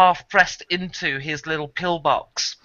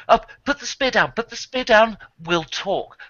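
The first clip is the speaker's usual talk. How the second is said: quick, higher-pitched mild urgency; lightly acted guards trying to defuse things; urgent guards; 6 semitones higher than usual. Guards to the spear holder, offering talk, mildly urgent. Game master voices alarmed guards.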